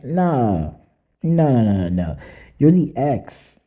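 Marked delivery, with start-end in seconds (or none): none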